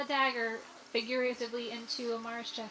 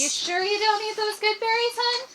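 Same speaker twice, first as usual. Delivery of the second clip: doting character voice; 11 semitones higher, 12 dB louder than usual. Acted. Player voices a caring character.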